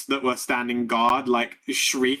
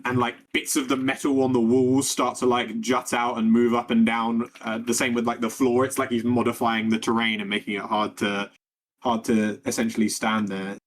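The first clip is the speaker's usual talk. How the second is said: ordinary talk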